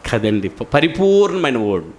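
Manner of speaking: usual speaking voice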